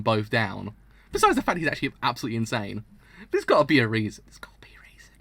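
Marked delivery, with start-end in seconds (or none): 4.3-5.1 breathy whispered repeat; hushed whisper. Real, not acted. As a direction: whisper it to yourself.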